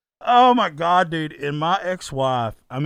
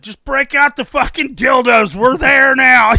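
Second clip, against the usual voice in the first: high, very loud imitation, building louder; mock drunken shout; 9 semitones higher, 15 dB louder than usual. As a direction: holler it like a reckless drunk host. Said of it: "it's exactly what he said".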